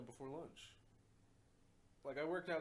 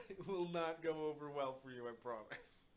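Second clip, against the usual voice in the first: laughing; 4 semitones higher, 6 dB louder than usual. Real, not acted. Laughing while warning.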